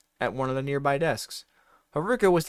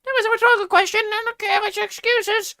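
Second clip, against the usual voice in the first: high, loud mocking teacher; stern teacher; 7 dB louder than usual. A narrator's reading. Teacher scolding a late student, high mocking voice. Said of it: "said Missus Banger".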